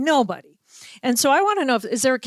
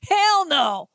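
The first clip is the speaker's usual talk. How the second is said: gruff refusal; 7 semitones higher than usual. Retold or imitated. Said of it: "He went".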